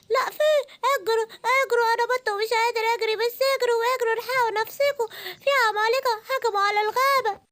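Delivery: high frantic warning cry; high frantic warning as a duck; panicked duck. Acted. Duck warns everyone. (بطة مذعورة بتحذر)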